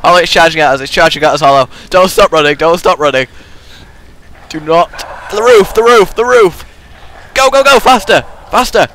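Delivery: breathless fright. Real, not acted. Fleeing a charging zombie.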